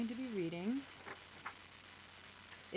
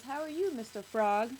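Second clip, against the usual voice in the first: friendly fruit seller; 3 semitones higher, 5 dB louder than usual. A narrator's reading. Man at fruit stand greeting the boy, friendly. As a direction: greet a child in costume playfully. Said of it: "and the man says".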